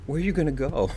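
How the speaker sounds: high-pitched questioning of the dog; talking up high to a dog; doggy voice. Real, not acted. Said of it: "talk in the doggy voice".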